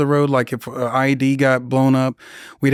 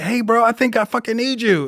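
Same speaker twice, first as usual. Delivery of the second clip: retold yell for help; 8 semitones higher than usual. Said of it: "I'm screaming".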